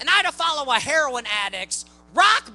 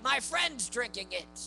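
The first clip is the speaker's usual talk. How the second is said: hushed excuse; 11 dB quieter than usual. Retold or imitated. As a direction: murmur it like a drunk covering up. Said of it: "I'm like".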